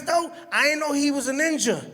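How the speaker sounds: ordinary talk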